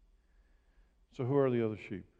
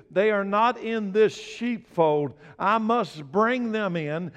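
higher, slower emphatic recitation; preaching scripture; 6 semitones higher, 6 dB louder than usual. Acted. Pastor proclaiming Jesus's words to the church, raised and slow.

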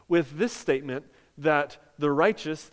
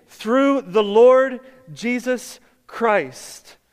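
louder, slow proclamation for the crowd to repeat; loud call and response; 5 semitones higher, 9 dB louder, much slower than usual. Shown as a demonstration. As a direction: lead the congregation in a declaration. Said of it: "Everybody say victory".

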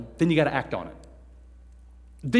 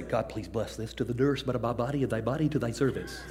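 low, soft, reverent grace with a laugh in it; hushed churchy blessing, half laughing; mock solemn prayer; 5 semitones lower, 8 dB quieter than usual. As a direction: imitate an impressive grown-up prayer. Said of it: "you heard somebody say".

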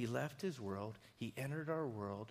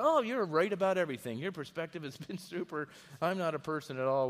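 mock fawning surrender; 3 semitones higher, 6 dB louder than usual. Mocking a codependent people-pleaser.

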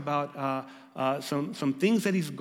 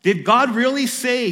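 loud, higher, sneering tempter; tempter's taunt; 7 semitones higher, 9 dB louder than usual. Acted. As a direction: needle Adam with doubt. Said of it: "the tempter that wants us to question".

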